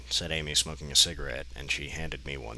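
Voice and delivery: normal talking voice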